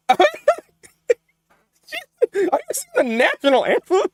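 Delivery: high, slow, stunned question; can't believe what chat is doing; stunned disbelief. Real, not acted. Streamer reacting to chat singing the anthem, stunned and high.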